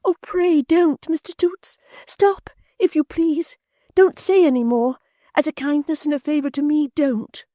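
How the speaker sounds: gently but urgently beg him to stop; distressed Florence. A narrator's reading. Florence distressed, pleading with Toots, high near-whisper. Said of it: "alarmed and distressed".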